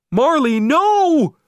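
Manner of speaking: high, loud, slow cry of no; desperate shout. A narrator's reading. Owner shouting at Marley pooping, high loud slow yell. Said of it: "I shouted".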